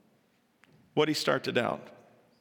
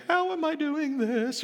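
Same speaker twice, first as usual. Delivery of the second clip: much higher, wondering aloud; amazed Peter; 12 semitones higher than usual. Acted. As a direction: Peter stunned at his own steps.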